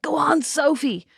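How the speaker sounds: high, drawn-out dad cheering her on; encouraging dad. Retold or imitated. Dad urging daughter onto the water ride, encouraging. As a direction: stretch it out as an eager urging. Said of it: "Dad was like".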